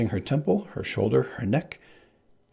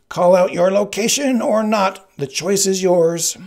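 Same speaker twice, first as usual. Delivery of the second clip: much higher, louder, slow; Sicilian's voice; 10 semitones higher, 9 dB louder than usual. Acted. Sicilian about to throw the blood, high and slow. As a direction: drag it out as a threat.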